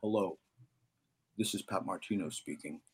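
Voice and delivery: [name]. Retold or imitated. Jazz legend introducing himself to a teenager by phone, imitated deep voice. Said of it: "this deep voice".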